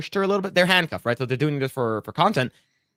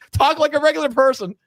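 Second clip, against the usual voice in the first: much higher mock voice; high mock plea to talk normally; mock exasperated plea; 14 semitones higher, 4 dB louder than usual. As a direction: plead it in a squeaky put-on voice. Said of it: "It's just like".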